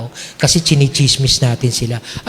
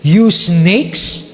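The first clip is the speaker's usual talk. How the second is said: "You snakes" said louder, as a stern rebuke; stern Jesus rebuke; 3 dB louder than usual. Acted. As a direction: nagsasalita bilang mahigpit na Hesus. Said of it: "nakakatakot magsalita ang ating Panginoon". (mahigpit at nakakatakot na pagsaway)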